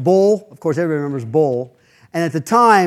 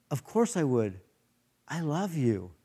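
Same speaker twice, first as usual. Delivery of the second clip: soft, tender reply; tender reassurance; 11 dB quieter than usual. Retold or imitated. Bull softly tells the weeping Flo he loves her, tender. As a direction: say it gently like a kind friend.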